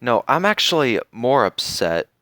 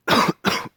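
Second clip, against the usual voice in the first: short unvoiced cough; real cough. Real, not acted. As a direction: cough mid-sentence. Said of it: "Oh man, I'm coughing".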